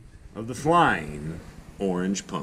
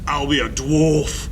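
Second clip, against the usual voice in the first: louder, higher gruff dwarf voice; jolly dwarf; 7 semitones higher, 7 dB louder than usual. Acted. Mister Bramble announces he'll be a dwarf, loud and hearty. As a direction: bellow it like a proud dwarf.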